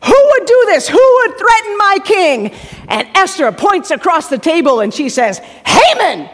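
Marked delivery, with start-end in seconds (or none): 0.0-2.5 much louder, higher furious outburst; king in a rage, shouting; irate king. Acted. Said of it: "He is irate". 5.6-6.3 higher, louder pointed call-out; name the culprit, loud; accusing cry. Acted. Esther accuses Haman across table.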